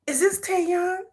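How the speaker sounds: high whispered question; mock whisper. Woman whispering on phone.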